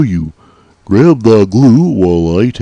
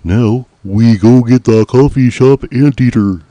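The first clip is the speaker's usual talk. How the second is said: ordinary talk, nothing marked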